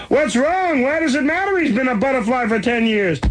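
high, odd creature voice; squeaky alien oyster asking. A narrator's reading.

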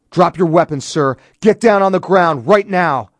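loud, high police command; forceful shouting, full authority; shouted order. A narrator's reading. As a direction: bark it at an armed suspect. Armed police order a man down.

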